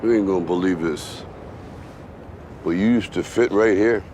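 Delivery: low, gentle fatherly voice; tender reminiscence. Acted. Father tenderly recalls son's childhood.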